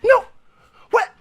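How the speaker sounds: yelled high and loud into the phone; enraged phone shout; furious yell. Acted. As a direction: scream abuse down the line. Angry phone outburst.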